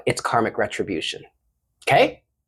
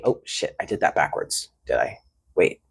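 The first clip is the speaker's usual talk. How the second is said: muttered to self; 11 semitones lower, 6 dB quieter than usual. Real, not acted. Realises card mistake mid-reading.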